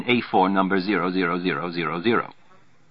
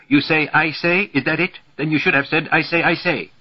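high, raised, exasperated quibble; screaming; 8 semitones higher, 5 dB louder than usual. A narrator's reading. Roger arguing with Volgi over words, screaming. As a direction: argue heatedly, voice pitched up. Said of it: "Roger has been screaming for a while".

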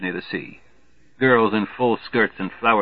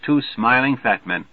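ordinary delivery, nothing marked

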